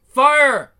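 loud high command; shouted order. Acted. Captain ordering soldiers to shoot, loud and high.

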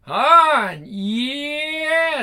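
high screaming moan. Acted. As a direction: scream it out in ecstasy. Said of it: "She basically screams on the phone".

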